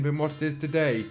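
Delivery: ordinary delivery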